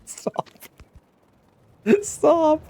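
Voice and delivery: squeaky high stop through laughter; laughing plea. Real, not acted. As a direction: beg them to stop mid-laugh. Begging chat while laughing.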